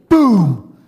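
loud spoken boom; preacher's mock boom; booming sound effect. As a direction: drop it like a thunderclap.

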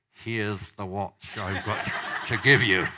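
slow, forced words through clenched teeth; grudging handover; through gritted teeth. Retold or imitated. Man handing over his watch, through gritted teeth. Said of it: "his teeth were together like that".

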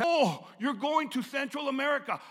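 loud, high, worried warning; mock-panicked outcry; mock alarmed shout. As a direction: shout it like a fretting relative. Mocking worried friends' warnings.

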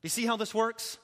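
usual speaking voice

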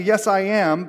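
"Yes, I am" high and loud; emphatic affirmation. Acted. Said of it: "he affirms emphatically".

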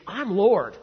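emphatic declaration. Acted. Quotes Jesus's bold claim to Pharisees.

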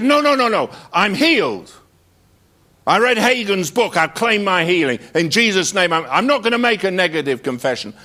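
high, loud, frantic insistence; insistent man. Retold or imitated. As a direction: mimic the stubborn man protesting he's healed, high and loud. Retold argument, man insisting healed.

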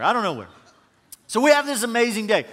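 normal talking voice

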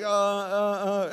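slow, loud, awkward mock hesitation; put-on flustered umming; imitated stammering. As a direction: stall as if caught off guard. Couple put on the spot.

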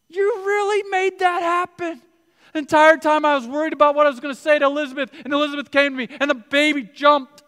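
high, loud, breathless wonder; Mary overwhelmed and thrilled talking to God; awed excitement. Pretend. Mary voiced in awe to God.

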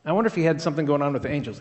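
ordinary delivery

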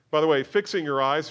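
loud, high, slowed preaching build; fiery pulpit delivery, loud and high; full-force preaching. Acted.

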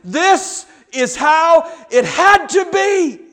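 slow, high declaration shouted to the world; triumphant cry. Acted. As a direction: ring it out as the plan fulfilled. Jesus' meaning voiced at the cross.

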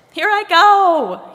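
high, loud, drawn-out call; shouted. A narrator's reading. Head rolls down the hill at the tree, shouting. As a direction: shout it out as you launch yourself off. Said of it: "he shouted".

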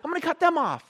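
hammed-up revenge threat; mock road rage. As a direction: play the vengeful driver. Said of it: "And I'm like".

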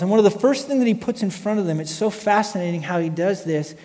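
ordinary delivery

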